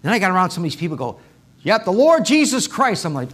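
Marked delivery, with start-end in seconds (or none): 1.6-3.1 louder, higher imitation of bold believers; bold proclaiming. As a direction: proclaim the name out loud, unashamed. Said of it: "but it wasn't proclaiming".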